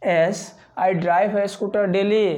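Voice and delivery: very slow, each word set apart; slow model answer. Shown as a demonstration. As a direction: model the answer slowly for the class. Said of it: "तो आप बोलिएगा". (धीमा, साफ़ उत्तर सिखाते हुए)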